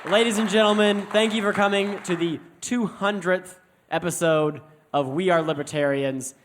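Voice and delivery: loud, high showman's intro, slowing at the end; emcee announcement. Acted. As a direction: announce the hosts like a ring announcer.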